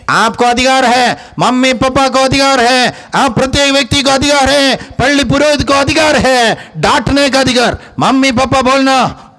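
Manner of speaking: high-pitched, insistent preaching; high, ringing exhortation; preaching at full force. Real, not acted.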